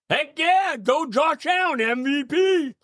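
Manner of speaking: high, excited fan cheer. Real, not acted. Fan cheering for his quarterback.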